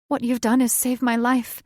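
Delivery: grateful witch. Acted. The witch reassures Thaddeus he saved her. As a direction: tell him warmly and eagerly.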